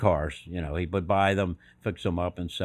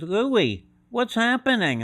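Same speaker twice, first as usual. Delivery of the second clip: lightly voiced small timid woman; a higher, thin little voice asks; 13 semitones higher, 5 dB louder, slower than usual. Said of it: "this little voice says".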